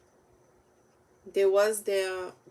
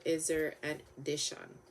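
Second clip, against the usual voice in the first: ordinary delivery, nothing marked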